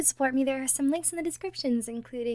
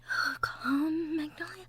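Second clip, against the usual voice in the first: ordinary talk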